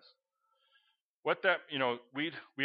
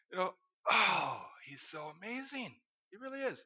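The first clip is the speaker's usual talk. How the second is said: hushed awe; 4 semitones higher, 3 dB quieter than usual. Real, not acted. Preacher marvelling at his God to the congregation, hushed awe. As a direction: sigh with wonder, dropping to a hush.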